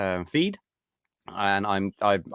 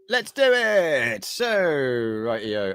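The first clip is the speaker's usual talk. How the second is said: drawn out; 3 semitones higher, much slower than usual. Real, not acted. Drawn-out show kickoff.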